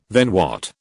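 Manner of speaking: plain reading voice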